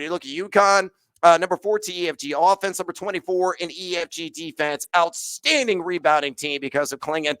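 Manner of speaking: ordinary delivery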